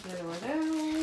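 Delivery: pleased with the find; delighted opening a present. Real, not acted.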